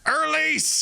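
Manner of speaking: Irlise! thrown out high and sure; high mimicked Irlise; mimicking Irlise. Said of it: "He said it like that".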